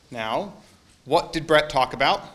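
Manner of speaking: usual speaking voice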